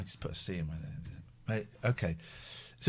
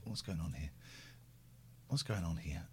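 quiet; 10 semitones lower, 18 dB quieter than usual. Pretend. Repeated line, much quieter.

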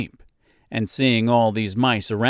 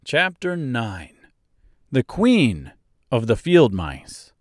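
usual speaking voice

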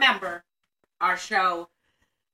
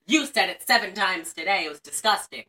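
ordinary talk, nothing marked

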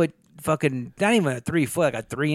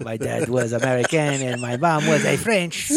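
hammed-up French life story; mock Frenchman introducing himself; horrible French accent. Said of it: "our horrible accents".

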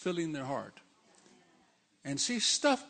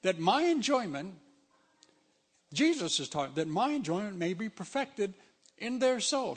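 ordinary talk, nothing marked

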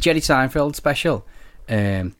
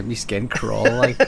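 put-on Scouse accent; slower than usual. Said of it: "bad Scouse accent".

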